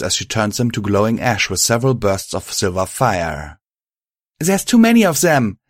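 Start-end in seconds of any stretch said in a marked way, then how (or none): 4.4-5.6 high, loud cry over a fight; urgent shout. Acted. Violet to her partner, back to back, urgent shout. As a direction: shout it to a comrade in battle. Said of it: "she shouted as we ended up".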